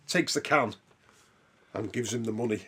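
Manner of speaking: ordinary delivery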